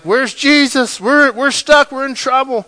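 high, loud panicked cry of men in a storm; panicked disciples. Retold or imitated. Disciples in the storm crying out for Jesus, panicked. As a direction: shout it like sailors sure they are sinking. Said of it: "they're like".

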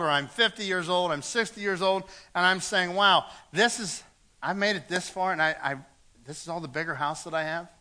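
ordinary delivery

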